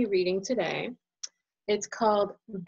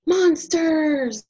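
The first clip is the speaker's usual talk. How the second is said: high, loud hammed-up fearful cry; mock scared hiss; 7 semitones higher, 7 dB louder than usual. Child hiding under quilt, mock fearful cry. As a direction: act scared of monsters. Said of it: "you hiss".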